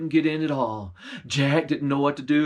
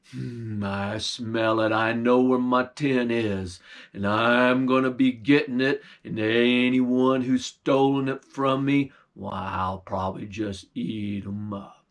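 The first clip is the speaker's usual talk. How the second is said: low bear voice; 4 semitones lower than usual. Acted. Bear sniffing out the stolen meat, low and menacing. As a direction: rumble it like a hungry bear.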